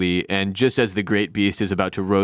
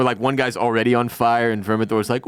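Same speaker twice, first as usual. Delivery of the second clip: ordinary delivery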